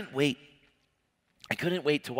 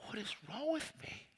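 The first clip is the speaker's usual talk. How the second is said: near tears; 11 semitones higher, 12 dB quieter than usual. Retold or imitated. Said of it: "I'm, like, crying".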